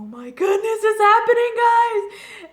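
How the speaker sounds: overjoyed fan screaming at the screen; fangirl squeal. Retold or imitated. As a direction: squeal it like the ship just sailed. Retelling her reaction to proposal.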